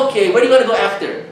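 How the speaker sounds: high-pitched mock question; pestering girl. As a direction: imitate someone nosy and pushy. Imitates girl pestering him.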